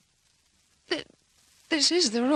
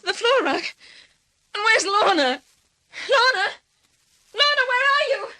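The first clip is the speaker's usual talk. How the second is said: frantic calling; 8 semitones higher than usual. Acted. Woman searching the room calls for her missing sister, frantic. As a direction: cry out for her sister, desperate.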